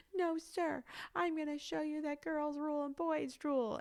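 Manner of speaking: put-on high, tearful little-girl voice; imitated crying child. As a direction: wail it defiantly like a small pupil. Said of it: "she's, like, crying. She goes".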